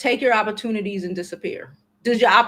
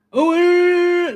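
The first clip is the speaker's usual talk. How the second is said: mock brush-off; 7 semitones higher, 7 dB louder than usual.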